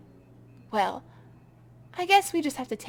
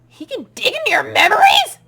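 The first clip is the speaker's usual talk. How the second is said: shocked outcry; 9 semitones higher, 14 dB louder than usual. Acted. Shocked at creature's power.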